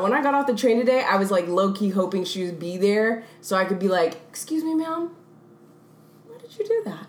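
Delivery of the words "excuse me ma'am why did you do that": soft, high, overly polite voice; mock-polite confrontation. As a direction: ask timidly, as if approaching a stranger. Imagined polite confrontation, acted out.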